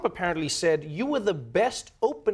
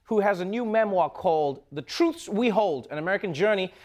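big, raised talk-show welcome; show host intro; 5 semitones higher than usual. Real, not acted. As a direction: introduce the guest over the applause. Host announces the guest.

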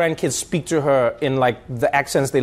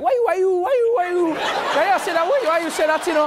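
put-on high, fast Haitian-accented questioning, laughing; 13 semitones higher than usual. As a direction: mimic the Haitian friend's rapid "why you". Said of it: "He'll be like".

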